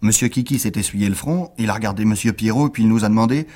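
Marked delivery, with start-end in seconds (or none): none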